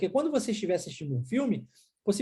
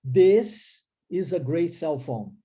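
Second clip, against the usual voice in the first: slow, clear "This is a great cell phone"; slow model sentence; 2 semitones lower, 3 dB louder, much slower than usual. Shown as a demonstration. Teacher models the sentence slowly for students. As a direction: say each word slowly for learners. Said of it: "Aí eu falo".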